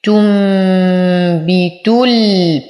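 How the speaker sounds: تنبت ال with a long "m" hum before ب; very slow, ن of تنبت hummed as "m"; iqlab with ghunna. Shown as a demonstration. Teacher demonstrating iqlab, sustained nasal "tummm-bitul" on تنبت ال. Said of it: "فالحكم إقلاب مع الغنة".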